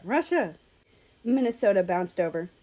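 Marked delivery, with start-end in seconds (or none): 0.0-0.5 higher call across the yard; excited hail from afar. Acted. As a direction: call his name so he hears you. Minnesota calling them over.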